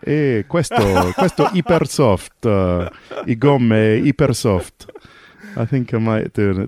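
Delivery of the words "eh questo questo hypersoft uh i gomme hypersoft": slow hammed-up Italian accent, louder, with a laugh. Shown as a demonstration. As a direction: play a Pirelli man selling his tyres in Italian. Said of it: "do it in an Italian accent next year".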